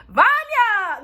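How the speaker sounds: high drawn-out mock cry of joy; over-the-top gleeful kid calling out; exaggerated excited greeting. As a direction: call out the name high and long, hamming it up. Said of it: "he didn't say it like that".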